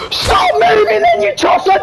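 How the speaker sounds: very high frantic shout; frantic yell. Real, not acted. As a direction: scream at him. Yelling at teammate.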